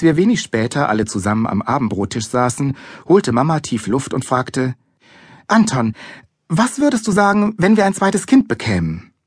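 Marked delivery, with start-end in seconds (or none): 5.5-9.1 high, tentative mother's voice; careful mother's question. A narrator's reading. Mother asking Anton about a sibling, careful. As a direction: broach the news carefully. Mother gently broaches a baby.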